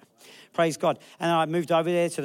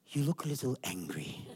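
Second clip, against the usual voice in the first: lower, soft remark with a laugh; gentle critique; 4 semitones lower, 8 dB quieter than usual. Retold or imitated. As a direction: imitate the mentor's mild observation. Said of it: "he's watching me preach and he goes".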